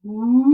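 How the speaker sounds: whale call going up and down; whale sound. Shown as a demonstration. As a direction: sing a rising then falling whale moan. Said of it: "Listen to my whale sound".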